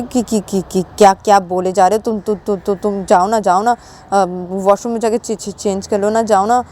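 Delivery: stuttering, embarrassed urging; acted nervous stammer; flustered pleading. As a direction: stumble along while begging the friend to leave. Flustered, urges her to go.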